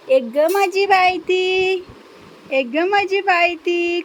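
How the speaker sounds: slow, high sing-song endearment; doting on a pet, slow and high; cooing to the parrot. Real, not acted. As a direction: croon it slowly to the bird.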